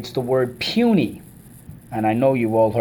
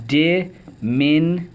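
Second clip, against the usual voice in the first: DIMINUTIVE slow and raised, in four separate beats; syllable by syllable; 5 semitones higher, 3 dB louder, slower than usual. Shown as a demonstration. Teacher cueing the class to repeat, syllable by syllable. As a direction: break DIMINUTIVE into di, min, u, tive. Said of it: "Let's go".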